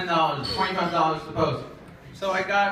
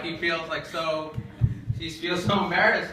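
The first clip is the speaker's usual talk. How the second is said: ordinary delivery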